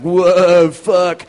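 a loud, sloppy yelp at the crash; drunk startled curse. Retold or imitated. Drunk passenger reacting to knocking the cyclist down, loud startled curse. Drunk man who opened the door.

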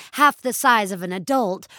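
usual speaking voice

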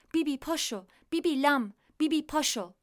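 high, loud bird voice calling; insistent high squawk to wake Bibi; starling waking her. Acted. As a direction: chirp it out like a pet starling at dawn.

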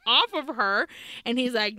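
ordinary delivery, nothing marked

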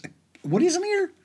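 high, fast incredulous cry; astonished. Retold or imitated. Dad shocked at the heavy backpack, high and fast. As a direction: squeak it in disbelief.

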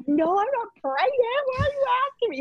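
very high mock blubbering; hammed-up tearful squeal; crying and blubbering. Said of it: "she was, like, crying and blubbering".

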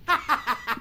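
boss's braying laugh; Trevor's laugh. Acted. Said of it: "Trevor laughed".

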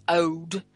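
put-on "ode" for old, L dropped, rounded vowel. Shown as a demonstration. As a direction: say "ode" with no L sound. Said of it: "they drop their L's".

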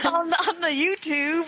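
playful old-timer impression; mock old person. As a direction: echo the grandpa line, laughing. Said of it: "I sound like an old person".

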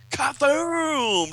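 high, loud comic-book blast; shouted explosion noise. Acted. Another blast hits headquarters, long loud boom. Reads out explosion sound effect.

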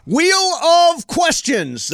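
big hyped segment announcement; announcer voice. Acted. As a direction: boom it out like a radio promo.

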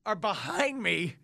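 ordinary talk

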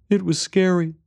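put-on high, small child voice; little boy. A narrator's reading. Boy tells stranger about lost mother, little boy's voice. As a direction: speak as a young boy, pitched high.

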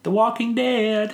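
high, held-out answer; sing-song ta-da reveal; drawn-out reveal. Real, not acted. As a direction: announce it high, stretching the last word. Triumphant reveal after a quiz question.

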